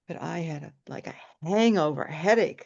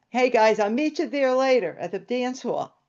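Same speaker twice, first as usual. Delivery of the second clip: raised, higher voice hailing a group; calling out; 6 semitones higher, 5 dB louder than usual. Retold or imitated. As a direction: call out cheerily to friends across the room. Brother calls to the group.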